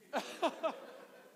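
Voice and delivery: high breathless giggle; real irrepressible laugh; relapsing laughter. Real, not acted. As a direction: burst into giggles while trying to stop. Trying to stop laughing.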